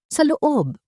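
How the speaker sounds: ordinary delivery, nothing marked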